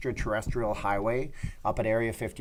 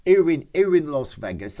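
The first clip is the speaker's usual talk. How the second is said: louder hammed-up British accent; mock British accent; 3 semitones higher, 6 dB louder than usual. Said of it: "offended all of the British people".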